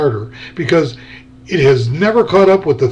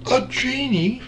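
high, soft rising disbelief; surprised question; 8 semitones higher, 5 dB quieter than usual. A narrator's reading. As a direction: ask it back in disbelief. Narrator echoes in surprise.

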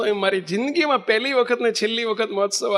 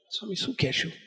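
much softer, quietly asking the swami; soft aside; 11 dB quieter than usual. Retold or imitated. Bapji asks the swami privately what to say, softly. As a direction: murmur it privately, leaning in. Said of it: "બાપજી મને ધીમે રહીને કે".

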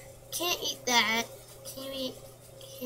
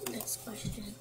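whispered; 3 semitones lower, 8 dB quieter than usual. Real, not acted. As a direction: say it under your breath, no voice. Whispered take of repeated words.

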